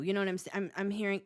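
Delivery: usual speaking voice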